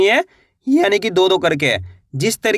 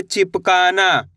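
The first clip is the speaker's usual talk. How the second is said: slow blended reading; much slower than usual. Shown as a demonstration. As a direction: stretch out चिपकाना slowly, syllable by syllable. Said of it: "कैसे पढ़ेंगे?". (चिपकाना को धीरे-धीरे खींचकर पढ़ना)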